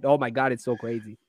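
usual speaking voice

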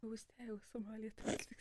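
put-on whispered, soft old-lady reminiscing; imitating an old lady. Friend as an old woman boasting, whispered. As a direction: hush it like a wistful old woman. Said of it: "she's gonna milk this and say".

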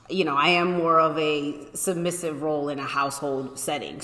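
ordinary talk, nothing marked

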